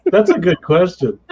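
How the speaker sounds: amused, chuckling answer; laughing. Real, not acted.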